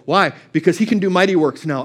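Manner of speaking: usual speaking voice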